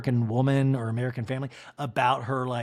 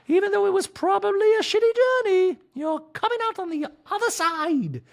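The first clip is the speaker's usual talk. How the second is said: much higher, louder sing-song wife voice; a doting wife, pitched way up; Dorit impression; 5 dB louder than usual. Wife nagging husband about salad, high mock voice. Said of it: "Dorit voice continues".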